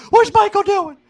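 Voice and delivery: squealing caricature of a shocked liberal; mocking liberals. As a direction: parody a scandalised critic, high and loud. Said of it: "the liberals would be like".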